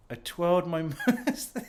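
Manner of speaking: laughing through the line; giggling at his own silliness. Real, not acted. Man alone on camera, laughing at his moustache.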